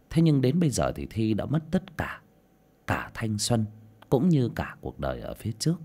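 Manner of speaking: usual speaking voice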